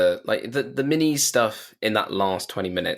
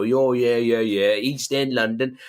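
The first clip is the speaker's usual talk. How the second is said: put-on East End London accent; slower than usual. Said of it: "it's his voice".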